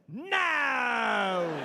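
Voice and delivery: long, high, falling mock shout of rejection. Rock star rejecting a rhyme, long scornful shout. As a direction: blast it out and let it slide down. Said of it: "And [name] said".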